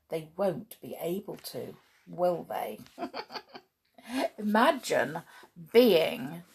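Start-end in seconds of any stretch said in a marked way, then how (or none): 3.0-3.6 soft laugh. Real, not acted. Laughs at own story.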